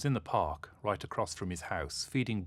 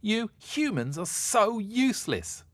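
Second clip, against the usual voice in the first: high, loud and slow tantrum shout; angry yell; 7 dB louder, slower than usual. Acted. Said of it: "she yelled, angrily".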